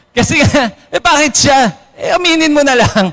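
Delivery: high, half-shouted "eh, bakit siya?"; hammed-up jealous shout; mock yell of envy; mock outburst.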